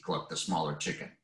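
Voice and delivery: plain reading voice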